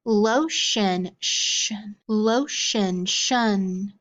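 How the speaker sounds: "lotion" then its ending "shun" alone, twice; sound card drill. Shown as a demonstration. Teacher showing a tricky card to the class, ending isolated. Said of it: "get ready for our sound cards".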